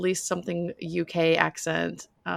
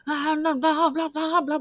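mock singer yelling; 9 semitones higher, slower than usual. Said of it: "he's just yelling and being like".

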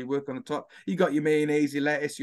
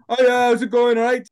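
loud, high broad Welsh accent; broad Welsh greeting; 8 semitones higher, 9 dB louder than usual. Shown as a demonstration. Showing how he sounds outside Swansea, loud broad Welsh greeting. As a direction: bellow a cheery Welsh hello. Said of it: "to people not from Swansea, I sound like this".

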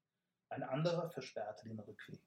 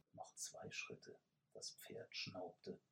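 ordinary delivery

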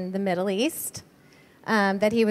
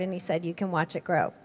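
moved, lower and softer; 4 semitones lower, 6 dB quieter, faster than usual. Real, not acted. Wife retelling her husband's promise to the church, moved. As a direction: let the voice sink low and soft, moved. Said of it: "Gets me every time".

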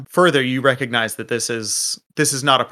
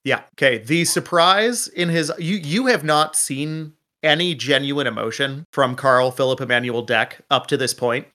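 usual speaking voice

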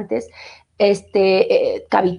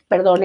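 dry cough; 3 semitones lower than usual. Real, not acted. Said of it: "esta tos, perdón".

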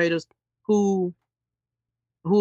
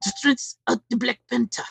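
mock African accent, pitched higher; Idi Amin accent; 4 semitones higher, 3 dB quieter than usual. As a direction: imitate Forest Whitaker's hammy African accent. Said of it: "do the Idi Amin, uh, accent".

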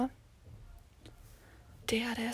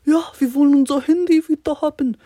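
put-on louder, higher whiny boys' voice; imitating the boys; 5 semitones higher, 8 dB louder than usual. Boys tell her they want their phones back, whiny and loud. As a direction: whine it like bored schoolboys. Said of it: "Und die nur so".

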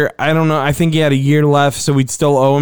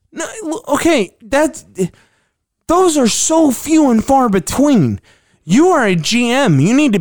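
exasperated; 8 semitones higher than usual. Real, not acted. Exasperated rant.

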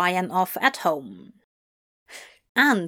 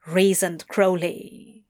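ordinary delivery

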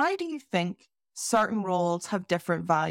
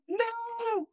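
mock wail; 5 dB quieter than usual. Mock despair after joke.

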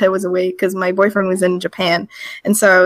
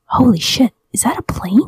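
hushed, whispered retold alarm; startled whisper; 2 semitones higher than usual. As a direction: whisper it like a sudden discovery. Retold startled reaction over Skype.

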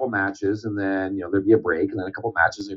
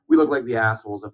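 ordinary delivery

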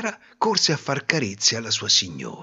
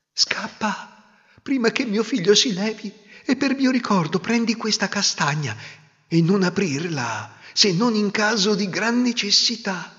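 lightly voiced, higher hurried warning; urgent old woman; 9 semitones higher than usual. Old mother of the wind urging the girl to flee. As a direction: hurry her off kindly.

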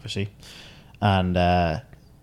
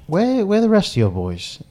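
high-pitched incredulous question; puzzled quote; 10 semitones higher than usual. Retold or imitated. Caddy asking golfers where their group went, puzzled. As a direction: act out a baffled reaction, pitch jumping up.

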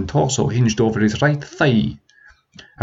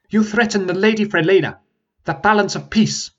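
prince accusing; 10 semitones higher than usual. Acted.

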